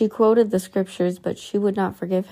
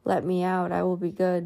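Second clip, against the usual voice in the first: ordinary delivery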